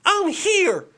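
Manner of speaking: very high, furious mock declaration; angry Patrick Mahomes. Playing Mahomes for his listeners, highest angry cry. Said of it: "He's pissed".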